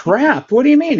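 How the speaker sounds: high-pitched, startled echo and question; baffled disbelief. Retold or imitated. To his brother who would feel trapped, baffled. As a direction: question it as if it were absurd.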